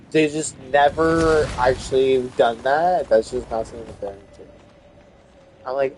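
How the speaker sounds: usual speaking voice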